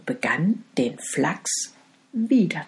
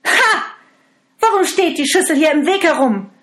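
much higher, loud, fast scolding; furious stepmother; 11 semitones higher, 7 dB louder, faster than usual. Acted. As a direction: snap at the girl over the spilled millet. Stepmother spills millet, scolds.

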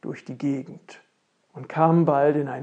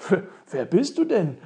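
stammered, louder question; higher, surprised prince; startled exclamation; 4 semitones higher, 6 dB louder than usual. Acted. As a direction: blurt it out, taken aback. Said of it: "rief der Prinz nun aus".

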